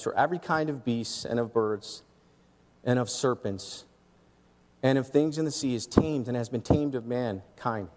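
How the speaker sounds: low, measured scripture reading. Acted. Pastor reading James to the congregation, low and measured. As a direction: read the verse low and even.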